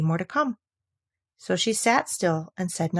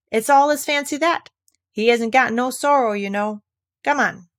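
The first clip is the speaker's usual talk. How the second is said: higher, louder, brusque creature voice; gruff Gryphon; 5 semitones higher, 4 dB louder than usual. Acted. Gryphon brushing off Alice's pity, lightly voiced. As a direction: wave it off like a rough beast.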